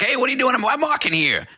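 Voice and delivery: high-pitched, hammed-up New Yorker; brash New York accent. Podcast host mimicking people in New York, brash New York accent. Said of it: "people in New York are like".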